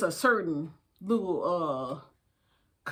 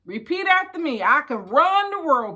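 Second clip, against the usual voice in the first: higher, louder, rousing call to repeat; affirmation lead; 5 semitones higher, 4 dB louder than usual. Shown as a demonstration. Cheering on her little sisters, a rousing affirmation. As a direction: pump them up to say it back.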